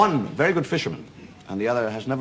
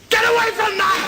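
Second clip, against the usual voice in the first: sharp high yell; sudden shout; 6 dB louder than usual. Real, not acted. Comic snapping at someone off set, sudden loud shout. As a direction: bark it out suddenly.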